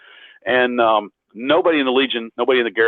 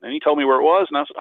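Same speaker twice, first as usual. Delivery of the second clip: normal talking voice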